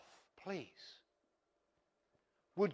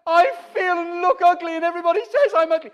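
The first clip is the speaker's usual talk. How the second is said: much higher, much louder anguished wail; crying out; 13 semitones higher, 15 dB louder, faster than usual. Pretend. The hurting self crying out against God's word, in a loud anguished wail. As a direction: cry it out in despair. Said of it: "everything in me cries out".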